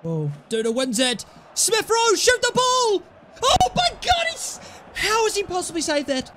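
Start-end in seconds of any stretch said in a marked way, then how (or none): 1.5-6.3 real excitement; excited yelling. Real, not acted. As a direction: scream at him to shoot. Urging a shot, then disbelief.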